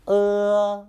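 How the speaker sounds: Uh held high and level; long, even Uh without any bend; first tone on Uh. Shown as a demonstration. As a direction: sing out Uh on one steady high pitch. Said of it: "Now the first tone".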